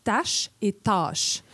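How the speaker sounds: "tâche et tâche" very slow, first with a front a, then a back â; tache versus tâche. Shown as a demonstration. Teaching the a and â contrast, slow and careful. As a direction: draw out the two words to show the vowel change. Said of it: "la distinction entre le mot".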